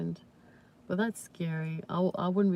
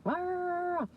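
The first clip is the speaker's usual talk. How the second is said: high, drawn-out mock growl; small dog's growl; 13 semitones higher than usual. Owner imitating small dog facing a bigger one, high growl. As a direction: imitate a feisty little dog standing its ground. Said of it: "but he's like".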